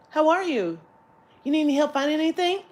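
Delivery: light chirpy clerk impression; friendly shop assistant. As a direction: imitate a perky clerk offering help.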